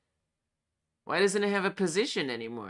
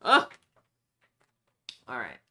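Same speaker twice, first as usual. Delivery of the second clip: usual speaking voice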